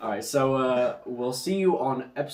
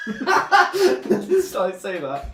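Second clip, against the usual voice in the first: slow words squeezed out through laughter; barely getting it out while laughing; 6 semitones higher, much slower than usual. Real, not acted. Catching his own slip, slow laughing.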